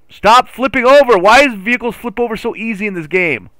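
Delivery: high, loud exasperated complaint. Real, not acted. Gamer complains about vehicles flipping, high and exasperated. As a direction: wail at the game in frustration.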